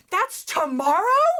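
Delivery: loud, slow, drawn-out fake shock; mock surprise. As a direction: pretend you just found out. Quoting her own email reply.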